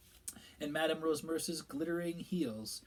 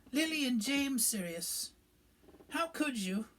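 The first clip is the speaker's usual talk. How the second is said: high, slow mock-sob of a plea; lightly imitating a sobbing cry; retold sobbing; 11 semitones higher, 5 dB louder than usual. Minister quoting Pettigrew's last words, a mock sob. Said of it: "he was sobbing".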